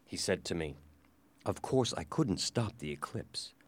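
ordinary delivery

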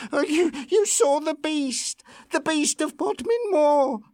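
high, stammering fear; shaking with dread at the news; trembling. A narrator's reading. Farm woman to Lucy, high trembling fear. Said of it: "beginning to tremble".

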